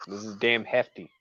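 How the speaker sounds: ordinary talk, nothing marked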